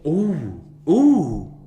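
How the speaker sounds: loud, rising ooh at the taste; exclaiming ooh at a bold sip; excited ooh. Real, not acted. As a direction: hit ooh loud and high, savoring it.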